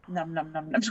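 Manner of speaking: low numb-tongue mumbling; numb mouth mumble. Retold or imitated. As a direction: slur it like a numbed mouth. Repeats numb mumble joke.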